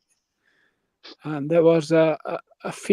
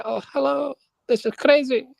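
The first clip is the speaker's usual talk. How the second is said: bad American accent, pitched higher; mock excited American tourist; a clumsy put-on American accent; 7 semitones higher than usual. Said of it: "In an American accent".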